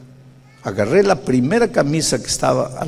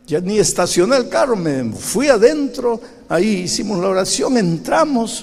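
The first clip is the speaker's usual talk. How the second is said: usual speaking voice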